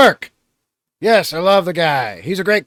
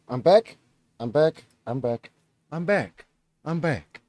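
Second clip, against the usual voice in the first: fading repetitions; 4 semitones lower, 9 dB quieter than usual. Pretend. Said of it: "I have returned".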